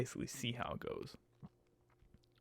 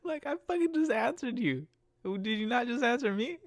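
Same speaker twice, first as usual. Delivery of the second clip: much higher, louder incredulous laugh; disbelieving exasperation; 11 semitones higher, 4 dB louder than usual. Real, not acted. Driver venting about passenger's repeat question, exasperated high. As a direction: burst out in disbelief.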